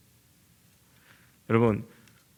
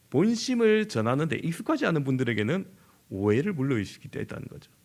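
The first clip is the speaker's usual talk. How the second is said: usual speaking voice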